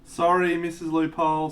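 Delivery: a loud, high apologetic sorry; loud apology. Real, not acted. Apologising to a viewer, loud and high. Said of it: "Sorry, my voice sucks".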